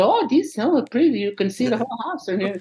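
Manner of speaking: high delighted little mom voice; high mock mom cooing over the loft; imitating mom. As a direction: gush like an impressed tiny mother. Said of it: "She's go".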